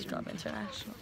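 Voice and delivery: very soft, voiceless whisper; whispered aside. Real, not acted. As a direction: whisper the name under your breath. Quietly echoing the club's name.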